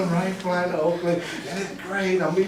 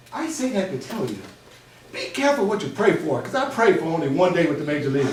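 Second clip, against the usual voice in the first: ordinary talk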